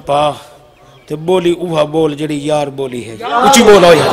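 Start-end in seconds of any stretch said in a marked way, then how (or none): 3.2-4.1 loud, raised prompt to the crowd; calling out. Real, not acted. Preacher urging listeners to respond louder, calling out. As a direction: rouse them with a quick shout.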